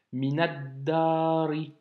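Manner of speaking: "Minaddari" slow, all run together; linked reading. Shown as a demonstration. Teacher models the linked "Minaddari" slowly. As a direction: glide "Minaddari" without a break. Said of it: "faire la liaison avec le mot d'avant".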